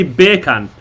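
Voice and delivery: "bacon" said as Jamaican "beer can"; Jamaican accent. Shown as a demonstration. Showing the bacon trick, Jamaican accent. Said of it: "Hey, Jamaican".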